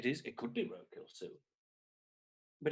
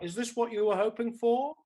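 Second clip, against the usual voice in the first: ordinary talk, nothing marked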